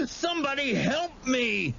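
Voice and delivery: high, sobbing repeated call for help; cry for help. A narrator's reading. Terrified boy calling again for rescue, sobbing.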